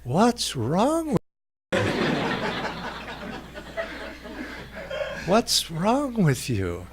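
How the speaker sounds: slow, laughing disbelief, breaking up mid-question; mock the friend through giggles, drawn out; laughing at him. Friends laughing at his outburst, slow laughing question.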